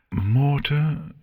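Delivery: plain reading voice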